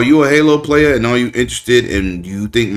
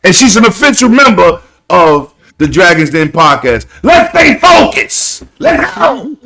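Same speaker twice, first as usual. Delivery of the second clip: high, loud hype-man cheering; 12 semitones higher, 6 dB louder than usual. Real, not acted. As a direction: pump the crowd up.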